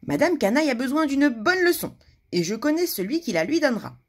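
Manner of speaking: confident announcement. Acted. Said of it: "annonça Monsieur Petit".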